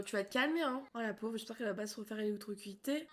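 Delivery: ordinary delivery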